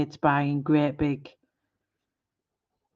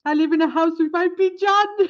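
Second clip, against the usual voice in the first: pigeon video guy; 12 semitones higher, 7 dB louder than usual. Retold or imitated. Retelling the video guy talking to his pigeon, high and loud. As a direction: imitate the viral pigeon man. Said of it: "this guy going".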